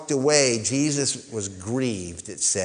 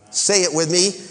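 usual speaking voice

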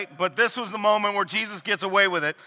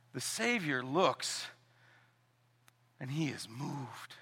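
much softer, slow and gentle; tender; 3 semitones lower, 10 dB quieter, slower than usual. Real, not acted. Preacher describes the Savior moved, soft and slow. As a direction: speak it softly, with reverence. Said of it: "tender solemn turn".

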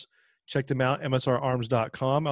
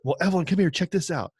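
higher, louder calling voice; excited parent; 7 semitones higher, 4 dB louder than usual. Pretend. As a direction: call her over excitedly. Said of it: "No, that never happened".

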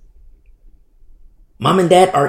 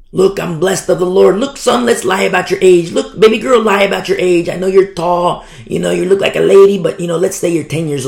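louder, higher mock-parent patter; mimicked coaxing parent; 5 semitones higher, 5 dB louder than usual. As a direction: talk the kids into it, pushy and quick. Acted parents coaching children to lie.

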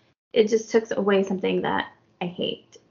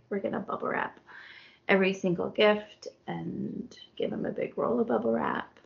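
whispered plan, softer; conspiratorial whisper; 6 dB quieter than usual. Retold or imitated. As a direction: whisper it like a secret scheme. Eric plotting gift in secret.

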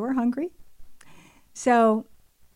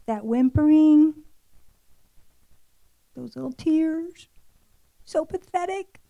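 high-pitched, cooing mock sympathy; mock pity; 4 semitones higher, 3 dB louder than usual. Mother describing a child's small fit, with mock pity. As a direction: play up how pitiful the child looks.

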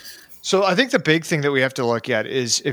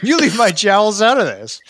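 laughing; 7 semitones higher than usual. Real, not acted. Laughing protest about his jowls.

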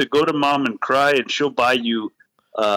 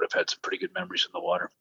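whispered innuendo; 5 semitones higher, 8 dB quieter, much faster than usual. Acted. Joke repeat, whispered innuendo.

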